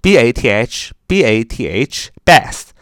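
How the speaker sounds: slow spelled B A T H; dictating. Shown as a demonstration. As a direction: spell each letter slowly. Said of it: "Bath是洗澡".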